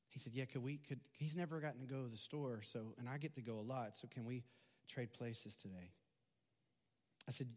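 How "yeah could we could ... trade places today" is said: quiet earnest plea. Retold or imitated. Boy pleads to trade places.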